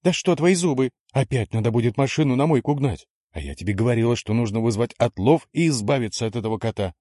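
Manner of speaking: high, indignant wife's complaint. A narrator's reading. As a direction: scold him, outraged. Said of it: "возмущалась супруга".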